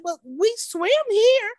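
lightly voiced kid, pleading for sense; worried child. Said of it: "I'm like".

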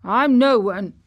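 put-on loud, dry old countryman; understated dry retort. A narrator's reading. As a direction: put it drily, with weight. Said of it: "said old Ben dryly".

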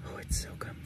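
hushed whisper. Real, not acted. Late at night in bed, whispering softly. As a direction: keep it to a breath.

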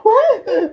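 very high, loud shocked gasp and what; loud mock gasp. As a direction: gasp like housemates hearing doom. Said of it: "most of the population is dead".

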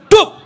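sudden loud high thud sound; splash noise. Retold or imitated. Preacher making the splash of the wife falling in, loud and high. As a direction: blurt the splash as she drops.

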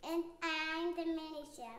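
quiet, unsure little answer; hesitant. Real, not acted. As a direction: say it with a bit of doubt. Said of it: "a little bit of hesitation".

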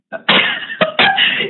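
squealing laugh at high pitch; nervous squeal of laughter. Real, not acted. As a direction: burst into a high giggle. Nervous laugh watching trade.

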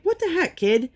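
high, incredulous mock exasperation; exasperated disbelief. Mom reacting to daughter eating no hot dogs, incredulous. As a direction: squeak it out in disbelief.

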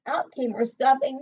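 story narration. A narrator's reading. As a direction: build the drama for the child. Dramatic story reading.

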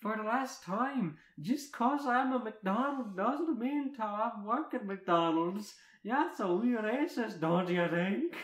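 high, loud, slow exasperated rant; exasperated story figure, words stretched out; Merida. Acted.

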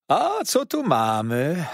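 loud, high, drawn-out question; waiter's eager, slow cry; calling out. A narrator's reading. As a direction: exclaim over a discovery with relish. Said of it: "zawołał".

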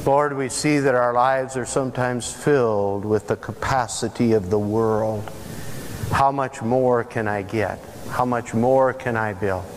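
usual speaking voice

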